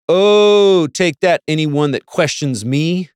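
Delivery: high, loud gloating oh; triumphant. Real, not acted. Speaker gloating at anyone who questions her, triumphant. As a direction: crow it over the doubters.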